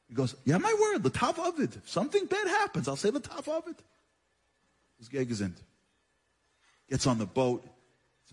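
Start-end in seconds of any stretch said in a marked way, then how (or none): 0.4-3.8 put-on much higher, earnest young man's voice; eager young student. Young student promising the Rav, high eager voice. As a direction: pitch it up high like a nervous young man swearing his word. Young man gives his word.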